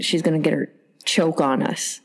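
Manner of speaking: ordinary delivery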